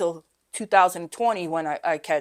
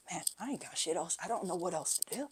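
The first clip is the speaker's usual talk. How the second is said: hopeless plea; 16 dB quieter than usual. Retold or imitated. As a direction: let it sink to a beaten-down murmur. Retold desperate moment at 4 AM.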